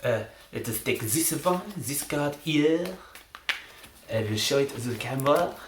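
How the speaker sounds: attempted French accent, showman's patter. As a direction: play the hammy French magician, accent and all. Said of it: "I will be attempting a French accent".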